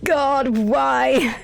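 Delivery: a sudden high, loud cry of disgust; Oh God why, wailed out high and loud; pained outcry. Real, not acted. Streamer cringing at the radio caller, pained outcry.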